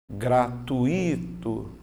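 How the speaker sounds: "gratuito" drawn out as gra-tu-Í-to; common mispronunciation. Shown as a demonstration. Teacher showing how people usually say it, common mispronunciation. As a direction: stretch "gratuito" slowly with a separate, stressed I. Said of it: "como que as pessoas costumam fazer".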